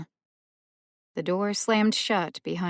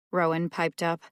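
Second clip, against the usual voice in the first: ordinary delivery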